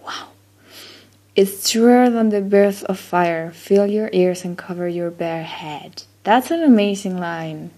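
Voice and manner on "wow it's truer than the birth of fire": normal talking voice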